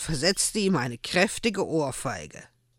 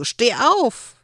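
calling out; 8 semitones higher, 5 dB louder than usual. A narrator's reading. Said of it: "rief Joel ihm zu".

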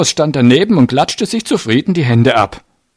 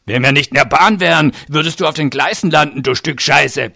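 angry bully with raised pitch; growled threat; 5 semitones higher than usual. A narrator's reading. Giant threatening to throw the man off the train, growling. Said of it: "knurrte der Fleischberg".

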